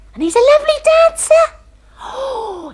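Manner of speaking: high, fast, breathless rapture; swooning high-pitched gush. Acted. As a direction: gush about him like a smitten girl. Gushing about her dance partner.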